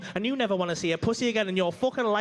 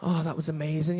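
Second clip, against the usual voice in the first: a hint of a blissed-out woman, lower; low, dreamy mock praise; understated breathless girlfriend; lightly voiced satisfied woman; 8 semitones lower than usual. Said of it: "And she's like".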